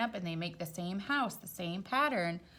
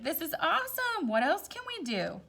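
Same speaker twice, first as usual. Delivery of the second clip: excited; 11 semitones higher than usual. Retold or imitated. Square thrilled, retold aside.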